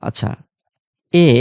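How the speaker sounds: ordinary delivery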